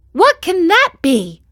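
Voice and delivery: high, loud wondering question; high, loud, curious puppet. A narrator's reading. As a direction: peer at the faint light. Pinocchio voice.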